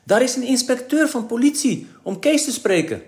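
high, loud alarmed announcement; frightened. A narrator's reading. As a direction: burst in with startling news. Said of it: "met een verschrikt gezicht binnenkwam en zei".

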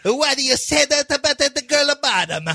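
mock Italian accent. Mocking Italian relatives' voice.

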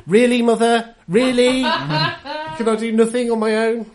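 loud, high whine at mother; mock exasperated son. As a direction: protest like a nagged son, drawn out and loud. Whining at mother mid-letter.

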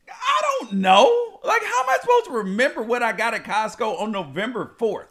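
high, stretched protest, then incredulous; exasperated. Retold or imitated. Husband replying to wife about Costco charge, exasperated. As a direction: draw out the first words in disbelief.

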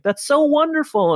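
high, gushing mock delight. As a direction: coo it like a thrilled neighbour. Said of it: "And he's like".

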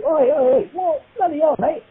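hammed-up London outcry; mock British protest. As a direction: yelp like a boxer just kicked. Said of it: "he went".

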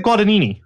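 Guadagnini with the middle G left out; dropped G. Shown as a demonstration. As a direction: say Guadagnini as "Gwa-da-nini". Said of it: "you drop the G".

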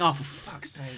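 hammed-up fed-up shopper; mock exasperation. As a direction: groan it like a pestered customer. Acting a shopper fed up with sellers.

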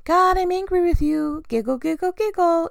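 high, sing-song mock giggly confession; making light of it. Shown as a demonstration. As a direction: play down the anger with a fake giggle. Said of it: "just gonna make light of this".

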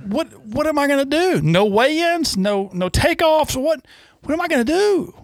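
high, pleading whine; hammed-up helpless moan; mock whine. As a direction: act out the helpless excuse to a buddy. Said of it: "And I said".

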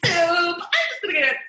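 high, fast, eager chirp; overeager cheer. Retold or imitated. Still trying to please her coworkers, she offers soup in a high, eager chirp. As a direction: offer it brightly, desperate to be liked.

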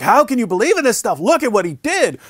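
high-pitched, indignant challenge; blasting. Acted. Debater confronting Muslims with the information, blasting. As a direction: go on the attack, appalled. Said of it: "just start blasting with this stuff".

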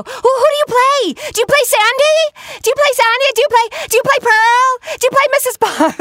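put-on very high, loud kid voice firing questions; excited kid. Fan kids quiz the voice actress, very high eager kid voice. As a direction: imitate an eager child quizzing her. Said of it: "the other half of the kids go".

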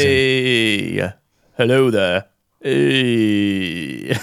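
lightly voiced action star attempting British; slurred try at a UK accent; Stallone. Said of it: "a, a U K accent".

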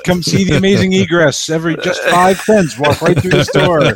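high, showy barker call; carnival barker. Pretend. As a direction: hawk it like a sideshow barker.

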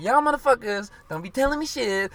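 put-on [name]'s Dominican New York accent, high and loud; a loud, high [name] accent rant; imitating [name]. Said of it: "the way in her accent and shit".

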